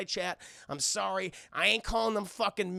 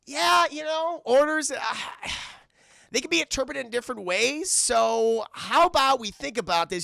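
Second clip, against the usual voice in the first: nervous wheedling; 7 semitones higher, 5 dB louder than usual. Retold or imitated. Cruise wriggling out of orders.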